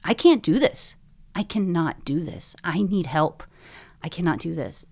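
emphatic, loud bursts of pleading; retold desperate plea. Frightened patient begging God for help at night, retold desperate plea. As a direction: re-enact a desperate prayer, hammering each refusal. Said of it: "I was shaking".

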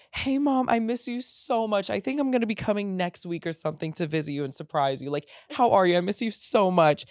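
high, gushing and fast; overcompensating sweetness. Retold or imitated. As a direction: lay the sweetness on too thick.

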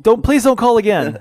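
high, loud, stammering fake phone breakup; bad connection. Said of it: "trying to pretend like".

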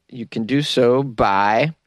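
ordinary delivery

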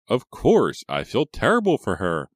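high, loud, overdone sympathy; unconvincing. A narrator's reading. Reva claims to pity her cousin, unconvincingly. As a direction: protest concern without meaning it. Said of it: "Reva said unconvincingly".

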